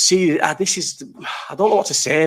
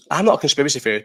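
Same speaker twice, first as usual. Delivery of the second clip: ordinary talk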